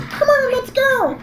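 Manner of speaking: high squeaky voice. Retold or imitated.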